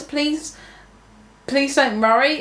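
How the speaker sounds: normal talking voice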